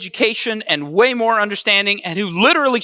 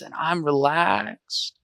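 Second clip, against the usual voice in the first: low, slow and soft, as if lying on the beach; laid-back beach drawl, low and unhurried; nice and slow; 5 semitones lower, 7 dB quieter, slower than usual. Shown as a demonstration. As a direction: ease it out lazily, stretching "relaxed". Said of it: "say it kinda nice and slow".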